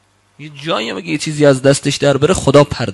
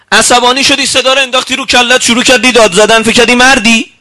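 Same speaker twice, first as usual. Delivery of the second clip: scolding rebuke; 8 semitones higher, 4 dB louder than usual. Acted. Preacher rebukes shouting men.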